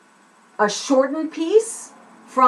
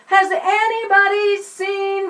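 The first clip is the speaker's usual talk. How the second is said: high, slow call to a crowd; acted kid appealing to strangers; 8 semitones higher, slower than usual. Shown as a demonstration. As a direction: stretch the words out to carry across a zoo. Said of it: "then they call out".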